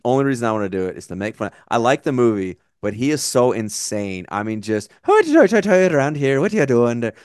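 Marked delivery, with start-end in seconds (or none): 5.0-7.1 hammed-up stage Irish, higher and louder; mock Irish accent. Mocking Tommy Lee Jones's bad Irish terrorist accent. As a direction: ham up a bad Irish brogue to mock the film. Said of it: "his accent is Lucky Charms-esque".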